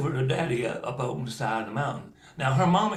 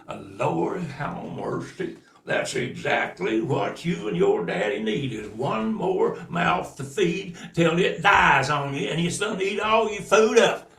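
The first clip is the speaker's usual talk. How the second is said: plain reading voice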